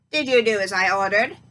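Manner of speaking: loud, booming crone voice; roaring witch. Acted. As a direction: roar it across the hut. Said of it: "she roared".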